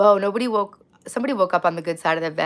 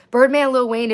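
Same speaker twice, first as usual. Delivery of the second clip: loud callout; 6 semitones higher, 9 dB louder than usual. Acted. Loud meme callout of rappers.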